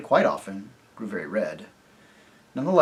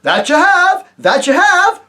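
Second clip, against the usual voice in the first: high, loud, eager agreeing roar; the other voices roar their eager agreement; roaring chorus; 12 dB louder, slower than usual. Acted. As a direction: shout it like a crowd of cheering yokels. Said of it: "roared the other voices with great enthusiasm".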